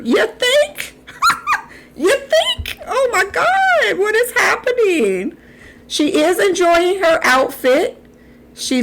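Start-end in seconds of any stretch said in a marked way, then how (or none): 0.0-0.9 high sarcastic "You think?"; sarcastic squeal; sarcastic retort. Real, not acted. 2.0-5.4 high, slowed shocked cries; high sarcastic disbelief. Real, not acted.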